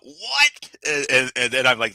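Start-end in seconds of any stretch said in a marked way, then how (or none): 0.0-0.7 high-pitched mock yell of disbelief; incredulous high squawk, no real volume; retold yell. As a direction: shoot the word up high, imitating a shocked friend. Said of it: "you yell out".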